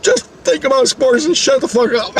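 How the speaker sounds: high, exasperated retort; retold squawk to silence someone; flustered snapping. Shutting down James's questions, flustered snapping.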